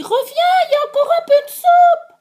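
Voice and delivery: very high, loud, fast squeaky call; baby bear calling. Acted. Baby bear calling Goldilocks back for soup, squeaking. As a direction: call after her like a tiny cub. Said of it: "le petit ours dit".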